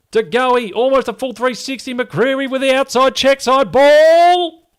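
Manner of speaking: high, loud, rapid race caller call. Acted. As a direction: call it like a horse race finish. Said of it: "You sounded like a race caller".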